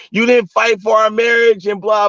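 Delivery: hammed-up nagging ex-wife; mock angry texts. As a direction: read her texts out like she's yelling them. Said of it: "I got all kind of text messages".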